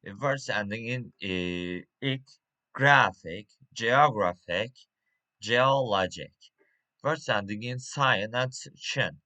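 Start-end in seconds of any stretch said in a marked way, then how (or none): 2.7-6.3 "graphic", "geographic", "geologic" hit loud on the next-to-last syllable; "graphic, geographic, geologic" stressed just before the final "ic"; penultimate stress. Shown as a demonstration. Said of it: "stress on penultimate".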